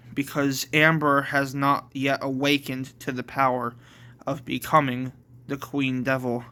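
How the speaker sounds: plain reading voice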